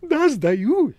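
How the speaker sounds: very high, loud cat voice; affronted talking cat, high-pitched; huffy cat Behemoth. Acted. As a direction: squeal the surrender with offended pride. Cat surrenders.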